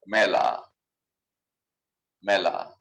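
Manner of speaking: মেলা with an open "ae" vowel, as in "mat"; correct Bengali pronunciation. Shown as a demonstration. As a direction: say মেলা as "mae-la". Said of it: "শুদ্ধ উচ্চারণ মেলা".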